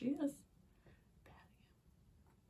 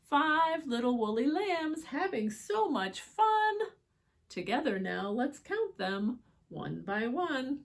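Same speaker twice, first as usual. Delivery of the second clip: put-on louder, lilting read-aloud for kids; storybook reading; 4 semitones higher, 6 dB louder than usual. A narrator's reading. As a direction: read it brightly to children. Reading picture book aloud.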